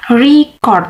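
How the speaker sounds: "Record" with RE stressed, as a noun; stress on first syllable. Shown as a demonstration. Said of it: "stress is on the first syllables".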